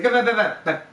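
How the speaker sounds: imitated brush-off, talking over someone; dismissive interruption. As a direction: cut them off with rapid nonsense syllables. Friend cutting off the questioner.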